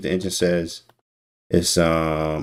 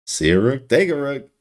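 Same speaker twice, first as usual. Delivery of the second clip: laughing through the line, the last word higher; 5 semitones higher than usual. Shown as a demonstration. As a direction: chuckle while you repeat it, amused.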